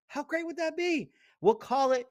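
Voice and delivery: mock wonder at a self-evident idea; sarcastic. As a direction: sell the obvious as a bright idea. Sarcastic freedom of speech riff.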